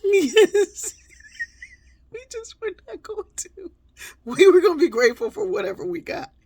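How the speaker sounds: high, loud laughing exclamation; real high laughing emphatic agreement; emphatic yes. Real, not acted. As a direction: burst out laughing, fully agreeing.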